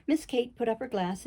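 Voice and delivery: ordinary delivery, nothing marked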